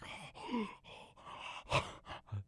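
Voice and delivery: high rasping dog wheeze; wheezing gasp. Retold or imitated. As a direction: imitate a wheezing old hound dog. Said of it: "one that breathes like".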